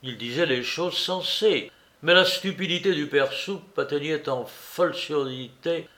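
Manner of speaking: usual speaking voice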